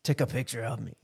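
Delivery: soft lightly voiced Arnold; a hint of Arnold, quietly; Arnold impression. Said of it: "That's my Arnold".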